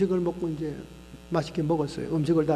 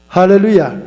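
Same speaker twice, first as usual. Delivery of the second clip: 할렐루야 shouted much louder, falling; loud hallelujah; 15 dB louder than usual. Real, not acted. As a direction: proclaim 할렐루야 at full voice. Preacher's hallelujah. (할렐루야를 크게 외치듯)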